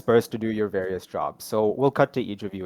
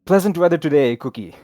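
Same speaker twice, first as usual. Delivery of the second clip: put-on Polish accent; 6 semitones higher, 7 dB louder than usual. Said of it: "Forgive my terrible accent".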